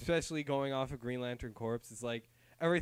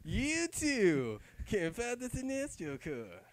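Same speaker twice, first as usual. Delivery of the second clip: high, slow commercial announcer; a cheesy pitch, drawn out; mock ad voice; 9 semitones higher, slower than usual. Co-host ends the fake ad, hammed-up announcer.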